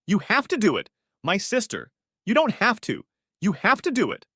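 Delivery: high, loud frantic pleading; almost hysterical. A narrator's reading. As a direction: beg in a near-hysterical rush. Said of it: "she said almost hysterically".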